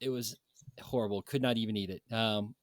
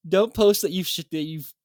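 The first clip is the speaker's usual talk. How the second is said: loud exasperated scolding; hammed-up forum rant; mock rant; 3 semitones higher, 7 dB louder than usual. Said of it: "Of course, I was kidding".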